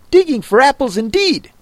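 mock his servant's words, irritated and quick; angry Rabbit. A narrator's reading. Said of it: "said the rabbit angrily".

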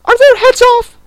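very high, loud shriek; piercing royal demand; shouting Queen. A narrator's reading. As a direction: scream the question imperiously. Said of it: "shouted the Queen".

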